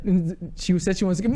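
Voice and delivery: ordinary talk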